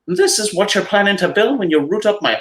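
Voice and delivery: indignant leprechaun. Acted. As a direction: scold the intruder as a proud old leprechaun. Patrick protests.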